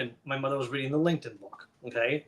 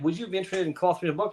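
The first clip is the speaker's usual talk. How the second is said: quick, higher voiced invitation; imitating the publisher; 4 semitones higher, much faster than usual. Retelling the publisher's invitation to co-author, in a quick lightly voiced imitation. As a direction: breeze through her offer, lightly voiced. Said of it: "Now, when she reached out to me, like".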